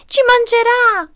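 long, high wailing cry; piglets' scream. A narrator's reading. Piglets fear being eaten, high drawn-out scream. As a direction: wail it out high, loud and drawn-out. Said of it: "urlarono i porcellini".

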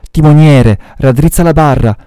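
high, urgent cry to the helmsman; shouted alarm. A narrator's reading. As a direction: call out in desperate alarm. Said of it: "gridò Starbuck".

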